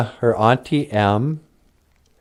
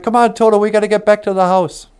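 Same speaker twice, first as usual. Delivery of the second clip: put-on urgent girl; 9 semitones higher, faster than usual. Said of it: "And she's like".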